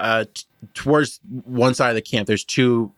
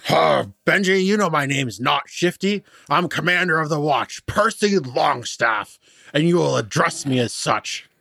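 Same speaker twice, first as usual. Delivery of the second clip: pompous commander; 5 semitones higher than usual. Acted. Pompous guard corrects Benji.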